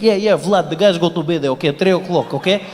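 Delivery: mock Russian phone chatter like scratched records; imitating a Russian guy on the phone; Russian accent. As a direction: rattle off Russian-accented English and Russian gibberish. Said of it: "DJ is scratching English".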